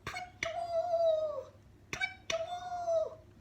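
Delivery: very high tweeting; bird call. Acted. The owl calling in the night garden, very high tweet. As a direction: chirp it like a tiny bird. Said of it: "went the owl".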